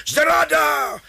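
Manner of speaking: high, loud outraged cry; roaring. Acted. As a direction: shout it as if betrayed. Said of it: "Pichl zařve". (zděšený vysoký řev)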